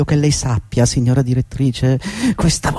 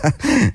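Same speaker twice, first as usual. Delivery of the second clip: burst of laughter; 7 semitones higher than usual. Acted. Laughs at imagined scene.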